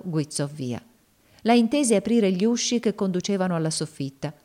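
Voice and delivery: ordinary delivery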